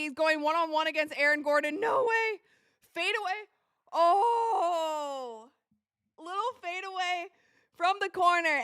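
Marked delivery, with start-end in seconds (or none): 1.8-8.6 high, rising cries over the buzzer-beater; excited disbelief. Real, not acted. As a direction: react live as the corner fadeaway drops. Watching the game-tying fadeaway live.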